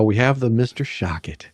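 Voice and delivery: normal talking voice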